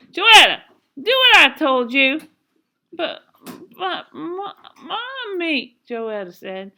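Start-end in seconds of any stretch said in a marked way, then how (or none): 0.1-2.2 much louder and higher, sharp command; angry mother's order. A narrator's reading. Mama to a balking Joetta, loud angry command. 2.9-5.8 high, halting stammer; small pleading child near tears; starting to sob. A narrator's reading. Joetta balking at mama's order, sobby stammer. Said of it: "starting to get all sobby".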